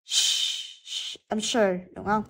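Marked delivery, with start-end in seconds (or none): 0.0-1.2 a drawn-out voiceless sh; sh sound alone. Shown as a demonstration. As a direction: hold sh as pure hushing breath. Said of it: "được phát âm là âm". (phát âm sh kéo dài, không rung)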